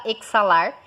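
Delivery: "exalar" said as ek-sa-LAR; "exalar" with a hard KS; wrong KS pronunciation. Shown as a demonstration. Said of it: "Você não fala exalar".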